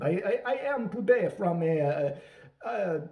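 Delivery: slow, halting fake Eastern European accent; mock Eastern European accent. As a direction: fumble for a country in a bad Eastern European accent. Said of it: "Hilaria Baldwin School of Accent".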